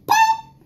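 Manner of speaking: sharp high pop; high pop sound. Acted. Button pops in story.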